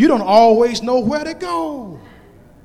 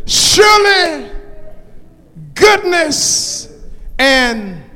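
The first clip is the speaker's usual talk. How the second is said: full-force preaching. Acted. Preacher proclaiming goodness and mercy will follow, full-force preaching. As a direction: preach in bursts with long held words.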